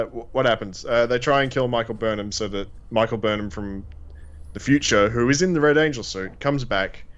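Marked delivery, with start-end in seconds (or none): none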